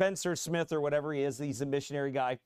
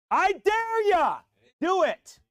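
high, loud, drawn-out dare; preacher's shouted dare; daring shout; 14 semitones higher, 8 dB louder, much slower than usual. Real, not acted. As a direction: throw the challenge at them.

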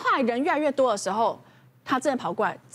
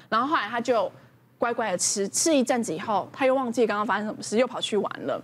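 ordinary delivery

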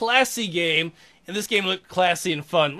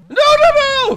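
high loud scream; panicked scream; 11 dB louder than usual. Real, not acted. Caught in game.

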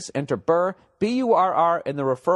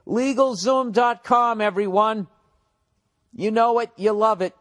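announcer hype; 6 semitones higher than usual. Acted. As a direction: sell it like a game-show host. Hyped ad read opener.

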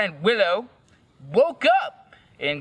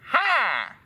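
higher, falling flirty "Hi"; flirty reply; 5 semitones higher than usual. A narrator's reading. Replying to Draco's greeting, flirty. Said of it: "I replied firmly".

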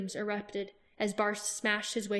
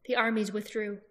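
ordinary delivery, nothing marked

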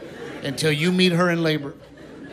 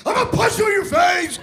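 high-pitched mock monster rage; hulked-out shout; 13 semitones higher than usual. Wife in labor threatens husband, hulked-out yell. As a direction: imitate a wife hulking out in labor. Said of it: "she'd hulk out on me".

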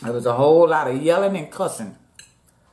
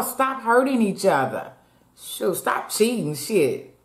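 heated rant; 6 semitones higher than usual. Real, not acted. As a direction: preach it at cheaters, worked up. Heated rant against cheaters.

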